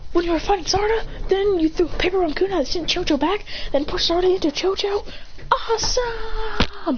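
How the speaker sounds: high, gushing recap; acted excitement. Teammate recapping the fight against Sarada, high excited voice. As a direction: gush high, stretching the last word.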